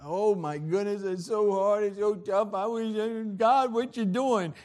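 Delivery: high, loud mock whine; complaining believer. Preacher mimics a struggling believer with a loud, high whine. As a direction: play the overwhelmed believer crying out. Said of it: "You might be looking at the outward".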